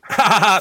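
short, loud burst of laughter; quick open laugh. Real, not acted. Laughs after excusing a burp.

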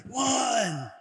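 lightly voiced ring referee; mock referee count. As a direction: call the count over a fallen boxer. Counting out the knockdown.

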